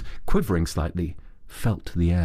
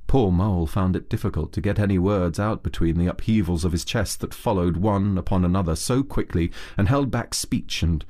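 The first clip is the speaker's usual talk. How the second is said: usual speaking voice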